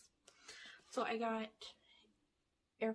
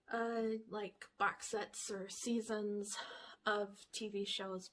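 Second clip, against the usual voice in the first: normal talking voice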